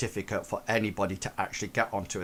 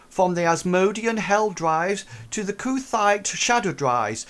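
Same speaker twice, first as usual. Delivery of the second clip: louder, higher lecture read as android; formal narration read; 6 semitones higher, 4 dB louder than usual. Acted. Android reads lore.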